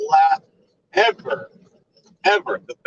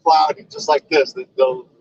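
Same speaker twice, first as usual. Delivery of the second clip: ordinary delivery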